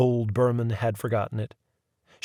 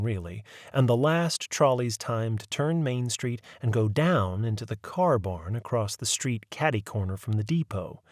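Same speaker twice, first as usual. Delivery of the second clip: ordinary delivery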